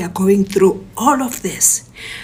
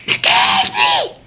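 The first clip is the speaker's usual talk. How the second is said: high-pitched scream, drawn out; screamed name call; 4 dB louder, slower than usual. Retold or imitated. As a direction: scream for someone across the house. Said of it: "I'm screaming on top of my voice".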